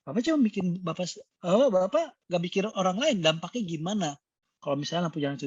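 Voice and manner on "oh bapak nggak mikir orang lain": normal talking voice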